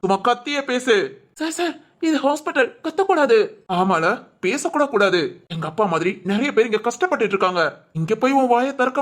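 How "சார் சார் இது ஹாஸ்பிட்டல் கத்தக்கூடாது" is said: high, urgent pleading; anxious plea. Acted. Nervous visitor begs angry man in a hospital, anxious plea. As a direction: beg him to keep it down. Begs him not to shout.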